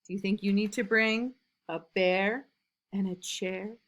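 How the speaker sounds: ordinary delivery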